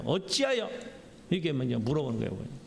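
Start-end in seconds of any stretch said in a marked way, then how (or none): 0.0-0.7 higher-pitched, curt challenge; acted gruff demand for a reason; brusque questioning. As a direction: ask it bluntly, without any deference. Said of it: "퉁명스럽게 이야기하는 거예요".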